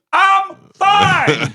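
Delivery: very high, loud mock voice of the fighter; imitated fighter brushing off the cop's question; high squeaky fighter. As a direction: squeak it out, breezily unbothered. Fighter answers cop.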